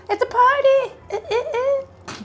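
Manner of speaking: giggling high party squeal; high laughing squeal. Real, not acted. Joking about disco-ball earrings.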